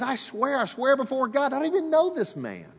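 frantic swearing denial; desperate oath. Retold or imitated. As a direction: plead your innocence, pitch climbing. Peter swearing denial, acted.

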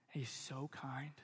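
soft, slow whisper. Real, not acted. Pastor telling his church how kind God is, in a slow whisper. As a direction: breathe it out gently, slowly. Tender aside about God's kindness.

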